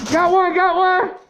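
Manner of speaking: high, slow triumphant call; excited shout. Real, not acted. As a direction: call out the kill. Enemy hit in firefight.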